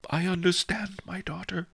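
high, tearful abbot; mild sobbing old abbot. Acted. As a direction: sob the words gently. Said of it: "the abbot sobbed".

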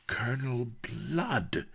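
high, drawn-out gasp of recognition; astonished exclamation. A narrator's reading. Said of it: "exclaimed Vanity".

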